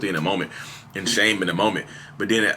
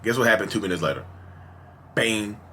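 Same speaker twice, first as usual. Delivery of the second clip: ordinary talk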